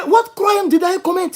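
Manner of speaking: high-pitched, affronted questioning; acted indignant protest. Driver challenging the officer's order, indignant. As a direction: replay the outrage of someone wrongly accused.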